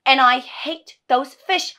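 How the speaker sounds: loud, high, slow and emphatic; exasperated outburst, each word driven home; furious loathing. Acted. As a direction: spit out the hatred at full voice. Rant builds to outburst.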